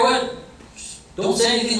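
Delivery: normal talking voice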